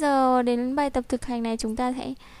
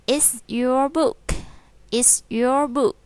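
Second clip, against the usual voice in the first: slow, louder model of "It's your book."; slow read-aloud; 4 dB louder, much slower than usual. Shown as a demonstration. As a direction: read it out slowly for the class. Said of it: "đọc to nào".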